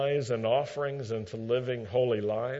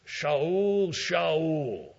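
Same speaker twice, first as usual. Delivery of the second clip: voice from heaven; 3 semitones higher than usual. Retold or imitated. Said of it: "this voice calls out and says".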